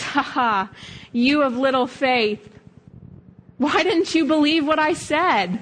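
a hint of a chuckling, fond Jesus; lightly voiced delighted Jesus; happy laughing Jesus. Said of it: "he's smiling and he kinda laughs".

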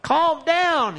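high, sharp calm down; mock hushing crowd. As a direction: snap at him to settle down. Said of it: "People are like".

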